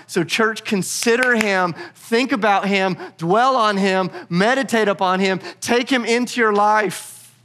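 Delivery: high-pitched, slow, emphatic exhortation; impassioned preaching. Real, not acted. Preacher urging church to consider Christ, impassioned. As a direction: press each command home, drawn out.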